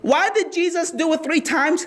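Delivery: normal talking voice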